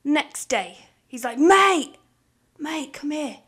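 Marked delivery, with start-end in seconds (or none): 1.4-3.4 sudden loud, higher call of mate; boss calling. Retold or imitated. Boss summoning employee again, loud high call. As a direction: yell across the room.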